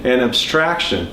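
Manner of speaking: usual speaking voice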